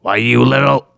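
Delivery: Homer's angry "why you little". Retold or imitated. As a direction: burst out like an angry cartoon dad. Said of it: "Homer, his dad, says to him".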